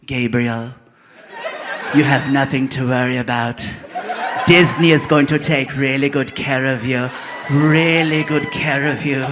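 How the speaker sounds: low, slow soothing corporate voice; Disney reassuring. Retold or imitated. Disney executive reassuring Gabriel, low and slow. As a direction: lull him with calm promises. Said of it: "They let you know right away".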